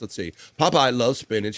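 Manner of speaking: ordinary talk, nothing marked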